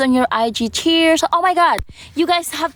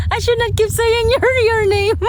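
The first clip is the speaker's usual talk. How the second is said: much higher, laugh-filled words; laughing loudly; 11 semitones higher than usual. Real, not acted. Laughing at the viewer's name, high giggly voice. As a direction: giggle out the words, too loud. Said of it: "Oh my God, I'm too loud".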